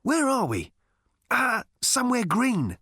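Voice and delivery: high-pitched, bewildered character voices; dazed exchange. A narrator's reading. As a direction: pitch it up, all at sea. Dazed pair hissing at each other.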